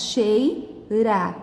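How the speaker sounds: "cheira" split in two, chei, ra; sílabas separadas. Shown as a demonstration. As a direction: say "cheira" syllable by syllable. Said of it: "As sílabas são essas". ("cheira" separando as sílabas)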